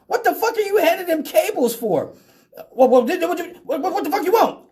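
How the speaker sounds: squeaky disbelieving yell; baffled cop. Retold or imitated. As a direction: play the cop who can't believe it, sputtering. Cop baffled by the jumper cables.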